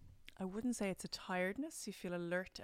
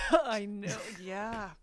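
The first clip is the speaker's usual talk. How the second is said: louder, laughing through the words; laughing agreement; 3 semitones higher, 11 dB louder, much slower than usual. Real, not acted. Host laughing back at the guest's tease.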